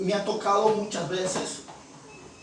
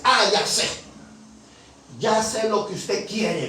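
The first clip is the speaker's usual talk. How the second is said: loud, higher acted exclamation; knowing, raised-voice woman's cry; amused realization; 4 semitones higher, 5 dB louder than usual. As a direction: burst out as if catching on. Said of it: "esta mujer se ríe".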